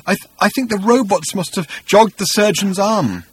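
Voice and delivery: high, tearful stammer; starting to cry. A narrator's reading. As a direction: stumble into it on the edge of tears. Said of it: "He started to cry".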